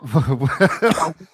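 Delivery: real laugh, falling away; burst of laughter. Real, not acted. Host laughs at lost voice.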